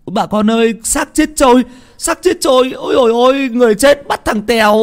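high, loud frantic shouting; father screaming to neighbours for help; panicked calling out. Acted. Father calls villagers for help.

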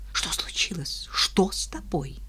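scared hushed whisper; frightened whisper. Acted. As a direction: whisper it in alarm. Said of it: "испуганный голос обольстительницы".

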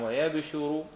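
ويبشر as wa-yab-shu-ru, ending in u; light-sheen reading. Shown as a demonstration. Teacher showing the Isra verse, ويبشر as wa-yab-shu-ru. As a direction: still b, single sh in ويبشر. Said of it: "وضم الشين مع التخفيف".

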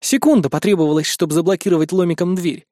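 plain reading voice

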